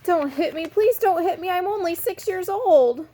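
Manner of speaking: put-on a scared small boy begging not to be hit; crying, pleading. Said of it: "Daniel started to cry".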